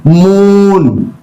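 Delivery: moon with a drawn-out "oo"; long vowel. Shown as a demonstration. Teacher reading the word list, long vowel in moon. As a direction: hold the vowel in moon, then let it fall. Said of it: "long vowel sound".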